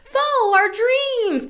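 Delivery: mocking the dreamy self-help cliché, high and slow; mocking pie-in-the-sky. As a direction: sneer at starry-eyed advice with a lilt. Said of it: "We're not pie in the sky".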